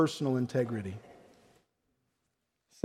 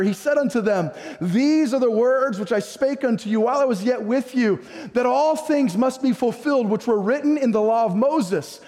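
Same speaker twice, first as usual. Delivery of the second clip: raised-pitch, driving sermon voice; fervent preaching; 6 semitones higher than usual. Real, not acted. Pastor expounding scripture to the congregation, fervent preaching. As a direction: preach it high and emphatic, stretching key words.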